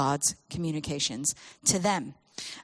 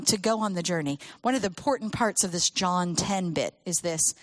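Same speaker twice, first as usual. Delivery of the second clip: usual speaking voice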